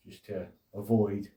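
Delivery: ordinary delivery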